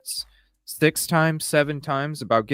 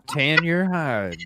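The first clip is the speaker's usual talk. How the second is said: slow, higher mimicked Southern drawl; teasing Southern echo of the threat; 4 semitones higher, slower than usual. Host mimics guest's threat back at her, Southern drawl. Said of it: "you're talking Southern right now".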